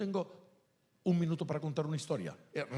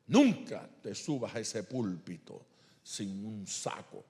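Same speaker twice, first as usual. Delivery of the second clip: a touch of the pastor, lower and slower; stern pastor; 5 semitones lower, slower than usual. Retold or imitated. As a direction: mimic the pastor laying down the rule, slow. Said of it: "pero mi pastor".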